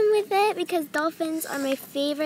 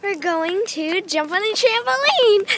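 ordinary talk

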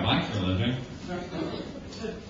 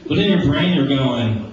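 ordinary delivery

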